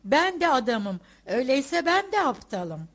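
indignant shouting. A narrator's reading. Respectable man confronting the writer, indignant shout. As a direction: shout it as someone taking personal offence. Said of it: "bağırmaya başlar".

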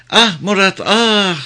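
high little-girl voice, playacting lament; small girl's mock yearning, pitched high; child mimicking lovesick sighs. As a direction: cry out like a child copying a lovelorn woman. Child repeats lovesick imitation.

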